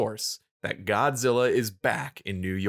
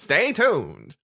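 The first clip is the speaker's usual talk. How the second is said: high, loud mock announcer sign-off; hammed-up broadcast tease; 7 semitones higher, 5 dB louder than usual. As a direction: sell the cliffhanger like a TV host. Mimics TV cliffhanger announcer.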